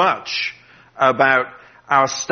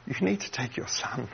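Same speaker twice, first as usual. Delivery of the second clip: real emotion, much softer, voice cracking on "only son"; fighting back tears while voicing God to Abraham; voice breaking; 5 semitones higher, 6 dB quieter than usual. Real, not acted. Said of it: "Sorry, this one's emotional".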